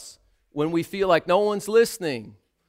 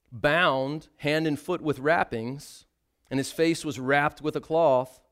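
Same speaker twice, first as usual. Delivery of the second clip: ordinary talk, nothing marked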